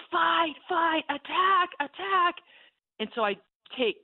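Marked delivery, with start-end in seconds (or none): 0.1-2.4 much higher, louder battle cry; shout. Retold or imitated. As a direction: urge him on to charge. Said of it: "And this shout".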